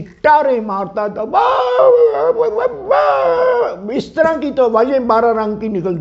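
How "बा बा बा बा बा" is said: long, high-pitched "baa" howls; imitated bird-scaring yell. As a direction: holler drawn-out wordless cries, far above speaking pitch. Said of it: "उसकी आवाज़ ही ऐसी थी".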